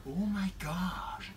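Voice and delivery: breathless whispered oh my gosh, slow; awed whisper. Acted. English girl whispering to the table in awe.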